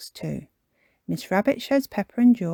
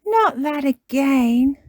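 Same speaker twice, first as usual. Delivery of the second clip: high, loud, drawn-out complaint; Peppa Pig; 8 semitones higher, 7 dB louder, slower than usual. Acted. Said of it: "says Peppa".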